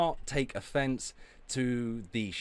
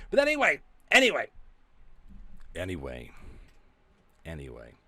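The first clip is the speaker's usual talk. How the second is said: fading anyway; 2 semitones lower, 4 dB louder, faster than usual. Real, not acted. Repeated anyway dropping.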